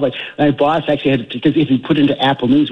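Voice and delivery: ordinary talk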